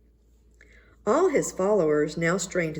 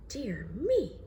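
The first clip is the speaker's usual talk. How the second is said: said to himself; 6 semitones higher, 5 dB quieter than usual. A narrator's reading. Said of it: "he said to himself".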